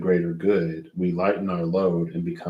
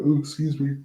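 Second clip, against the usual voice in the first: yawning; 8 semitones higher, faster than usual. Real, not acted. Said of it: "I'm yawny".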